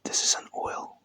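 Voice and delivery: soft unvoiced ASMR whisper. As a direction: breathe the words close to the mic.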